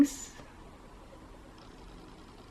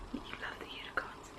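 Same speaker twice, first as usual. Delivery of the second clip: whisper; 22 dB quieter than usual. Real, not acted. Whispered aside to viewers.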